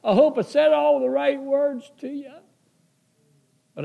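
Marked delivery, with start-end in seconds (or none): 0.0-2.3 high, louder tearful plea, half-crying; a touch of retold sobbing in the voice; retold weeping prayer. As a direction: pray through remembered tears, pitched way up. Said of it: "still weeping and crying".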